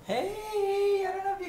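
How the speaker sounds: sudden very high greeting; high call. Acted. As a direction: pop in with a squeaky hey. New character enters calling out.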